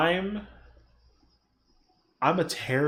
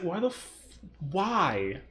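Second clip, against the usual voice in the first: ordinary delivery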